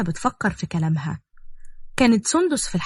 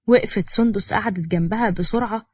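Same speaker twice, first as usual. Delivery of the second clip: plain reading voice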